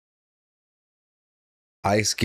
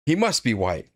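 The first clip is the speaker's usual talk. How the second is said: chuckling through the words, a bit higher; laughing; 5 semitones higher than usual. Real, not acted. Streamer repeats the remark to chat, laughing. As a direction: laugh it out, amused.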